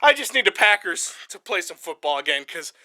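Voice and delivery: sag into it, spent; exhausted. Real, not acted. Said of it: "I'm exhausted".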